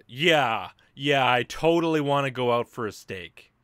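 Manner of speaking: loud mock enthusiasm; sarcastic agreement. Answering a steak invitation, heavily sarcastic. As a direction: agree loudly while meaning no. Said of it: "every sentence you say is sarcastic".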